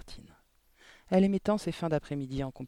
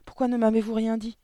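high, loud, hurt reproach; shocked exclamation; 7 semitones higher, 7 dB louder than usual. A narrator's reading. Girl reproaching her teacher for silence, shocked exclamation. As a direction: demand an explanation, voice raised. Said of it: "s'exclama Mathilda".

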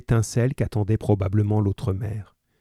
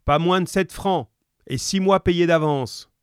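higher-pitched call from the back room; man calling from within; 8 semitones higher than usual. A narrator's reading. Husband shouts terms from the back, a contained call. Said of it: "une voix d'homme cria du fond de la gargote".

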